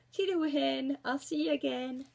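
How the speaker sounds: high, loud wolf calling goodbye; wolf's farewell. A narrator's reading. Wolf feared for the forester calls goodbye, loud and high. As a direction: call out a breezy goodbye as the wolf. Wolf takes hasty leave in story.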